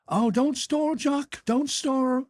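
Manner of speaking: high, slow, drawn-out don't stir; high mock-fussy fluster; fussy protest. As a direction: wave him back into his seat. Henchy waves off the offered chair.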